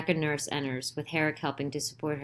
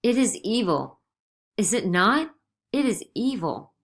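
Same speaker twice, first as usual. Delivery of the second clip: in great pain; 6 semitones higher, 4 dB louder than usual. A narrator's reading. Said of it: "In great pain".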